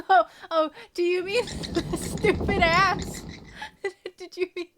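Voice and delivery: high, loud words broken up by giggles; real laughing. Real, not acted. Streamer asking if they mean the weird voice, laughing. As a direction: laugh helplessly through the question. Laughing reply about her voice.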